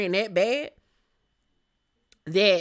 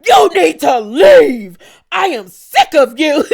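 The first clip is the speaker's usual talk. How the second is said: mock yell; 6 semitones higher, 16 dB louder than usual. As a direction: bellow it like Meredith kicking someone out. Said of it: "So Meredith was like".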